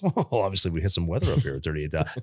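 low, soft, unbothered captain; calm pilot voice. Retold or imitated. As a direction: soothe the cabin like a pilot. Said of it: "the pilot goes".

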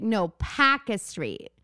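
slow Boston correction, "Packer" without the final r; imitated Boston accent. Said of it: "story about Boston accent".

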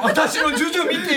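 high-pitched mock hostess pleading; bar hostess. Host plays snack hostess for laughs, high coaxing voice. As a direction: play the hostess pestering a customer. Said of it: "水商売が終わってきた人だよ".

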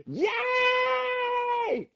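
high squealing yay; mock crowd cheer.

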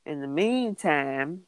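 usual speaking voice

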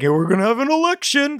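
high, silly proclamation voice; mock announcement. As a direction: announce it like a cheerful town crier. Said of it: "Do then they go".

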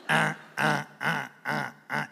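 low, repeated goose honk; imitated goose honks. Mother goose starting on her gosling, low repeated honks. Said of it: "lo tiene ahí y empieza".

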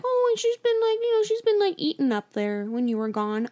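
high-pitched imitation of an excusing mom; imitated mom, high and chatty; mom explaining. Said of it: "my mom was like".

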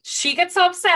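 usual speaking voice

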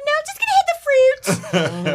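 high, loud, carefree younger self; naive younger self. Retold or imitated. Woman imitating her tipsy college self, high and loud. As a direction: squeal it like a clueless college kid. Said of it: "I was like".

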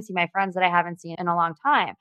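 ordinary talk

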